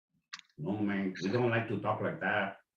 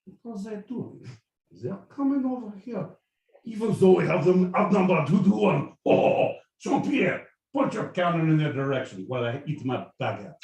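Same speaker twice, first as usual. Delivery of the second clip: mock French general; 8 semitones higher, 8 dB louder than usual. French general orders cannon.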